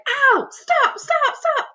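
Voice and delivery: very high yelping pleas; pained cries. A narrator's reading. Centipede pelted by hail begging the cloudmen to stop, very high yelps. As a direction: beg them to stop, yelping. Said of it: "he cried".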